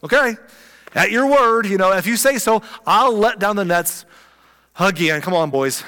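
half-sceptical but game, pushed up loud; a retold Simon calling out his go-ahead; eager fisherman. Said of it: "so Simon's like".

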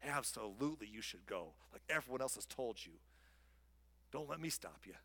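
low, soft, dry sarcasm; understated, dripping sarcasm; sarcastic. Acted. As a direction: agree with deadpan insincerity. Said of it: "obviously dripping with sarcasm".

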